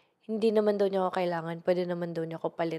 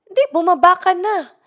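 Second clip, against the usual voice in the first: much higher and louder, a put-on angry man's yell; furious driver; 10 semitones higher, 12 dB louder than usual. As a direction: bark him out of the car. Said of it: "Galit na galit pa rin siya". (galit na galit na sigaw ng drayber)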